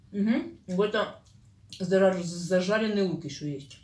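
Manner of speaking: talking between bites of food; mouth partly full, chewing. Real, not acted. Seen 0: Speaker leans over plate, eats, licks fingers, chews between phrases.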